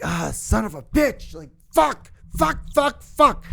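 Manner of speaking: high, squeaky frustrated cursing under the breath; exasperated cursing. Retold or imitated. Student caught by the principal, high frustrated cursing. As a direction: whine the curses high, like a cornered kid.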